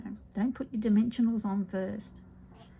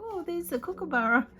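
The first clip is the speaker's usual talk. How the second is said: mock child voice; 8 semitones higher, 6 dB louder than usual. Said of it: "we go".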